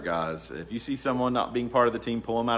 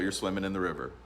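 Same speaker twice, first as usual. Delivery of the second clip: normal talking voice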